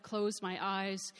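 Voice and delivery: plain reading voice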